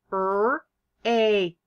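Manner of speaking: R-A-K said sound by sound, drawn apart. Shown as a demonstration. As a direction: sound out R-A-K with a gap after each sound. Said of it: "can you sound out rake".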